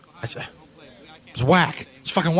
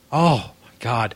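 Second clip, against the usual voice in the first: ordinary talk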